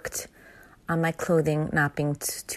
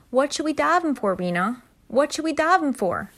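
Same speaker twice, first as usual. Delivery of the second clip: urgent question; 9 semitones higher than usual. Retold or imitated. Emotional plea in the chair.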